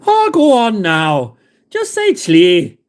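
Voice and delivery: slow, sing-song mock Irish brogue; slow, hammed-up Irish accent. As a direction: ham up a slow Irish lilt. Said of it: "that's more Scottish than Irish".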